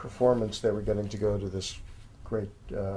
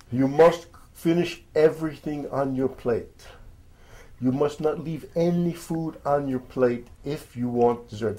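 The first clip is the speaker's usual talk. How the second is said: put-on higher-pitched, unconvincing French accent; attempted French accent; 5 semitones higher than usual. Restaurateur instructing high school students before lunch, shaky attempt at French accent. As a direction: lay down the rule in an amateur French accent. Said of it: "should attempt a French accent".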